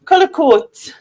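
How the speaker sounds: "Cullercoats" in a Sunderland accent, coats stressed. Shown as a demonstration. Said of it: "She just wanted us to say coats".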